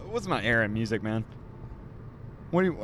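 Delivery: ordinary talk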